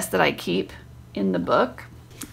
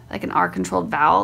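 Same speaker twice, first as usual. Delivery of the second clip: usual speaking voice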